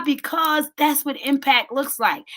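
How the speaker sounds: ordinary talk, nothing marked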